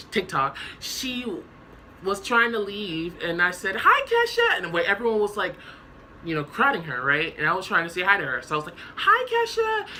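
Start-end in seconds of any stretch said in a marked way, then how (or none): 3.8-4.6 high, loud fangirl hello; excited greeting. Retold or imitated. Greeting [name] in a dream. 9.0-9.9 high, loud fangirl hello; excited greeting. Retold or imitated. Greeting [name] in a dream.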